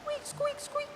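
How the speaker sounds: high, soft distant mouse squeaks; faint high squeaks from afar; faraway squeaks. Acted. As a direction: squeak as if far out at sea.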